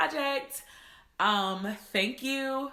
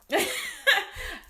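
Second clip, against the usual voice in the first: laugh. Real, not acted. Overjoyed laugh over own planner.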